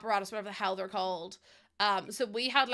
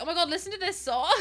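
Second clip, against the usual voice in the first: quick, higher-pitched mock excitement; excited gushing; 6 semitones higher, 3 dB louder, faster than usual. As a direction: gush it in a rush, reenacting. Acting out sharing music with brother.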